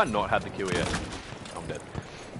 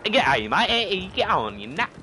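louder, higher go at a jumbled Aussie-English accent; mixed-accent attempt; 8 semitones higher, 5 dB louder than usual. Shown as a demonstration. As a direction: try a muddled Aussie accent, then give up. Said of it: "trying to think of, like, Aussie and".

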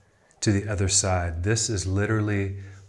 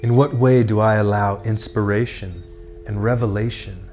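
measured, projected contemplative questions and affirmations; meditation prompts. Acted. Tarot guide voicing the listener's inner questions, solemn meditative delivery. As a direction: pose each thought deliberately, then leave long silence.

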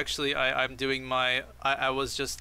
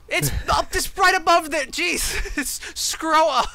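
high, loud exasperated yelling; aggressive shouting; 5 dB louder, slower than usual. Real, not acted. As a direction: yell it at them, fed up. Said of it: "you are very aggressive right now".

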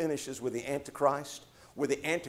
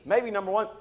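usual speaking voice

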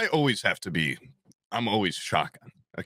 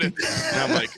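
ordinary delivery

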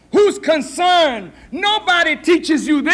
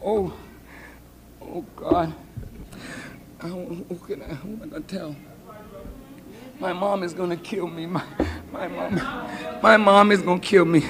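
low, hushed, halting dread; panicked pregnant girl; 8 semitones lower, 11 dB quieter, faster than usual. Acted. Girl reacting to her pregnancy, afraid to tell mom, hushed panic. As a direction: fret under your breath, stumbling over words. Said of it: "How you react".

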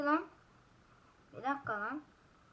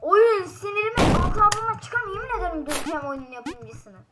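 furious yell; 5 semitones higher, 9 dB louder than usual. Real, not acted. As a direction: drag out the fury at the game. Gamer rages at the game.

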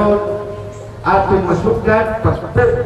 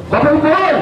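rally speech; 9 semitones higher, faster than usual. Real, not acted. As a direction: rouse the crowd with a cheer. Governor ends speech with rally cry. (singgit sa rali)

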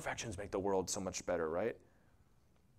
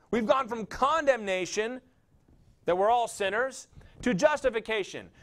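higher, louder full-voiced sermon; fiery preaching; 9 semitones higher, 5 dB louder than usual. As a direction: preach it out to the congregation. Climactic sermon on grace.

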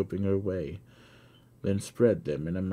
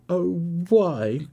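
a high, loud, tortured cry; anguished cry; 12 semitones higher, 8 dB louder than usual. A narrator's reading. Blind girl crying out at her father's confession, anguished. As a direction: pain almost beyond bearing. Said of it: "cried the blind girl, tortured".

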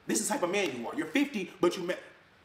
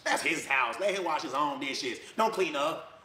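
mocking the mom; 5 semitones lower than usual. Mimicking the ex coaching her daughter against him, low and sneering. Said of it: "she would be coaching her daughter".

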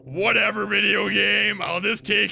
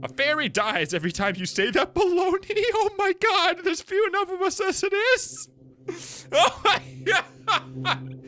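much higher, laughing fairy with a scolding edge; giggly fairy voice. Acted. Tooth Fairy scolding the kid who doubts her, high and giggling. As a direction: trill it like a tittering fairy who takes offence.

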